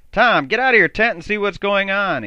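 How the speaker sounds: loud, high shout. Acted. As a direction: shout it across the camp. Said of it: "Andy shouted".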